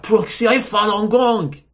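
usual speaking voice

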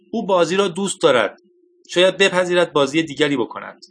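lightly voiced eager young man; excited idea. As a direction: blurt out the hopeful thought. David has a sudden idea.

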